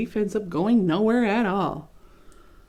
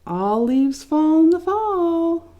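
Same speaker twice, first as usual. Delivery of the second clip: higher, louder and very slow mocking singsong; taunting; 10 semitones higher, 6 dB louder, slower than usual. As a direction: drag out the words to rub it in. Leaves taunting Leaf.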